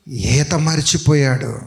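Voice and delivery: ordinary talk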